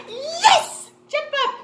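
very high, loud whoop; excited cheer. Pretend. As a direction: cheer the children into action. Teacher cheers a right answer.

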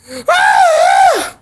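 high, loud frustrated groan; loud exasperated groan. Real, not acted. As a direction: let out a furious groaning yell. Exasperated outburst.

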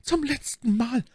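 very high, slow, drawn-out warning; exclaimed ultimatum. A narrator's reading. Milady gives Bonacieux a last chance, exclaimed ultimatum. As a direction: call it out high and slowly. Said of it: "rief My Lady".

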